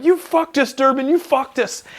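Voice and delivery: high-pitched mock wail; imitating a squealing staff sergeant. Retelling the staff sergeant blaming the marine, high mock wail. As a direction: squeal it like a boss in despair. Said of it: "I'll never forget Staff Sergeant Clem going".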